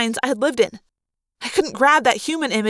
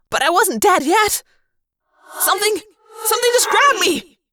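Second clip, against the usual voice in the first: panicked; 8 semitones higher than usual. Acted. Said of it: "I said".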